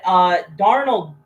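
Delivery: ordinary talk, nothing marked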